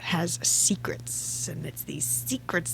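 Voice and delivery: slow, dramatic stage whisper; hushed reveal. Pretend. Fan revealing Xaden's signet theory, slow mysterious whisper. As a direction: drop to a drawn-out conspiratorial whisper.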